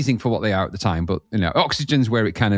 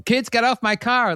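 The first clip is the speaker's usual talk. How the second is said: quoted voice; 10 semitones higher than usual. Retold or imitated. Quotes album voice clip.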